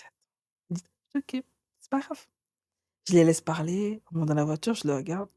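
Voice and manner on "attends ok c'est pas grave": breathy whispered warning; whispered aside. Retold or imitated. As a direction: whisper it under your breath. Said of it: "Je dis". (chuchoté, à voix basse)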